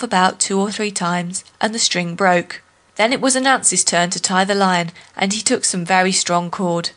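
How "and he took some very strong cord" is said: usual speaking voice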